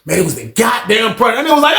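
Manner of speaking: normal talking voice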